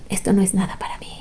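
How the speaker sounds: hushed mock bravado; whisper. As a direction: breathe it out like a secret brag. [name] boasting about the chili.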